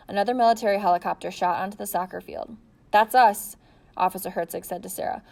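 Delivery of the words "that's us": much louder call over the rotors; raised over noise. A narrator's reading. Officer telling the girl over helicopter noise, loud.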